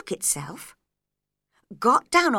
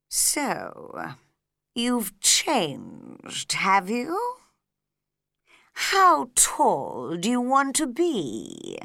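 slow caterpillar voice; 3 semitones higher, much slower than usual. A narrator's reading. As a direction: drag each word out lazily. Caterpillar questions Alice.

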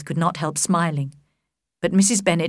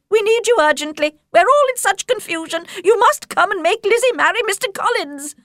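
much higher, agitated mother voice; a flustered mother begging for help, pitched up; fussy Mrs Bennet; 14 semitones higher, 3 dB louder than usual. Acted.

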